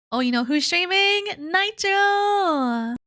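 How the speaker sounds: high, loud burst of excitement; excited. Real, not acted. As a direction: blurt it out with delight. Excited at a friend streaming.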